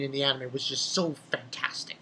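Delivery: whispered confession; soft unvoiced whisper. Real, not acted. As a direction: lean in and whisper it.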